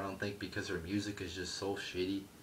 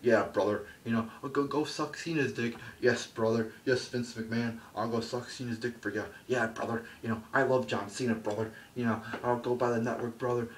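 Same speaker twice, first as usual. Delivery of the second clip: mocking Hogan as a yes-man; Hogan impression; 4 semitones higher, 4 dB louder than usual. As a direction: play him eager to please, brother. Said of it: "Hogan's like".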